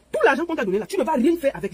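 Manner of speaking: ordinary delivery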